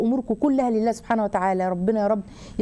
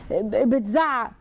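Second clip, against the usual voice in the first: ordinary delivery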